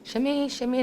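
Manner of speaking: imitated call for water; calling for someone. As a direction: call for help from bed, without shouting. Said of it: "she'd call for water sometimes".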